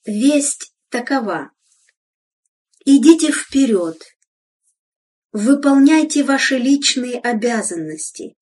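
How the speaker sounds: normal talking voice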